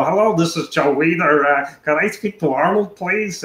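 lightly put-on prank caller voice; Joe Weider impression. Prank caller to secretary, lightly faked Joe Weider voice. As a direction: fake a business mogul's phone greeting. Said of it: "like, Joe Weider".

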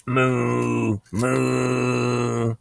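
long, drawn-out cow moos; a cow's sustained moo. Acted. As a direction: moo steadily on one held note. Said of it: "said the cow".

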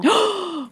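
quick, high gasp; sharp intake of breath; excited gasp. Real, not acted. Reacts to news of the ball.

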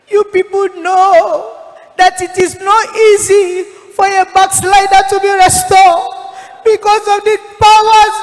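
high, loud, desperate crying plea; anguished wailing plea. Retold or imitated. Dead pastor pleading with the preacher, high and wailing. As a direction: wail it as a lost soul begging. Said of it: "And then he said to me".

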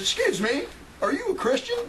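a lightly voiced suspicious clerk; mock incredulous clerk. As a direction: pitch shooting up on the question, mock disbelief. Clerk quoted in imagined bakery.

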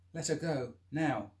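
a man warning an attacker to let go; firm demand. Acted. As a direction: square up and order him. Confronting the attacker.

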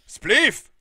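high, loud start call; starting shout. Real, not acted. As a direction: shout the word that starts the bout. Announcer shouts the start word.

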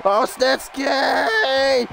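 long, drawn-out wailing shout; dismayed cry. Real, not acted. Gamer calling to the wrestler Snitsky, drawn-out cry. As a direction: stretch the name out in a wail. Crying out at a move.

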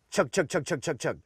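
quiet, rhythmic chug chug of feeding ducks; soft chuckle call. Shown as a demonstration. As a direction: murmur the chugs softly in a quick rhythm. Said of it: "I say".

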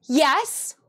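mildly excited quoted yes. Retold or imitated. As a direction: imitate her delighted agreement, lightly. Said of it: "excitedly goes".

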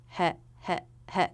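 "He" as a short, flat heh; clipped little heh, level each time; flat first syllable. Shown as a demonstration. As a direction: repeat a quick unstressed "he", vowel as in bed. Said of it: "The first syllable will be pretty flat".

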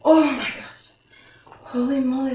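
ordinary delivery

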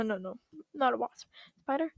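ordinary talk, nothing marked

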